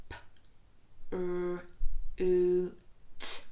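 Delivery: F, l, oo, t each said alone with a loud oo; sound-by-sound. Shown as a demonstration. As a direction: tap out each sound on a finger. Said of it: "see if you can do it".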